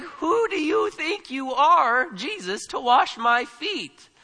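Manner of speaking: slow, high challenge leaning on "you" and "my"; emphatic challenge. Acted. Peter objecting to Jesus washing his feet, slow emphatic challenge on "you" and "my". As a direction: stretch "who do you think you are" as an indignant question. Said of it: "You and my are emphatic".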